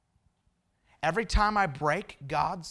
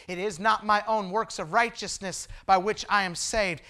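ordinary talk

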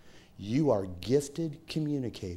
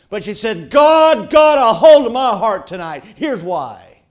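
raised voice; 11 semitones higher, 17 dB louder than usual. Acted. Loud retold story in sermon.

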